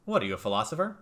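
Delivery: usual speaking voice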